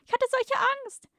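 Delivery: very high, put-on girlish falsetto; tender damsel. A narrator's reading. Rescued woman to her hero, hammed-up tender falsetto. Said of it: "hauchte sie zart".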